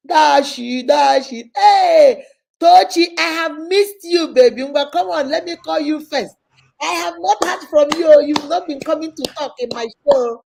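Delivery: high, loud, excited welcome; exuberant calling out. Real, not acted. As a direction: hail a long-missed friend at full voice. Greeting and calling on guests.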